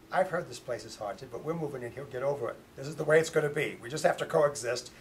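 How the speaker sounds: loud, brisk no-nonsense announcement; firm insistence. Retold or imitated. Daughter tells her family to get over the haunting, firm and loud.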